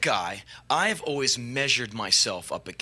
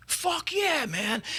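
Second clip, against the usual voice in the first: a sudden high yelp of yes; mock-thrilled high yeah; eager yes; 12 semitones higher, much slower than usual. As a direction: jump at the offer, way up high. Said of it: "And he's like".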